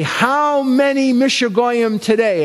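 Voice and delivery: father's voice. Retold or imitated. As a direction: voice the teasing immigrant dad.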